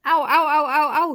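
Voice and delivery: loud, high repeated ows; shouted yelps of pain. A narrator's reading. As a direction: yell out in pain. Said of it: "shouted the giant slug".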